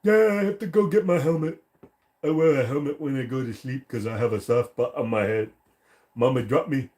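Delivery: put-on high, far-off dopey kid voice; silly kid character. Streamer clowning for chat as a high goofy kid. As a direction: play a clueless kid who was dropped.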